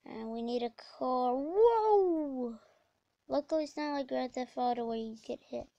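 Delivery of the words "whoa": drawn-out, higher, louder whoa; startled whoa. Real, not acted. Gamer jolted at the wheel, startled whoa. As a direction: let out a long yelp of whoa. Startled while driving in game.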